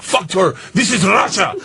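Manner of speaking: high, shouted Russian gangster bluster; mock a drunken Russian thug roaring; hammed-up defiant gangster shout. Drunk gangsters defy the teacher.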